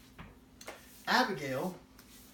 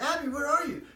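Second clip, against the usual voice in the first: calling out; 9 semitones higher, 6 dB louder than usual. Retold or imitated. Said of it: "So she says".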